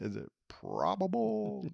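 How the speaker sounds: worried client, asking slowly. Acted. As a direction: fret over the word probable. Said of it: "I'm worried about a meteor".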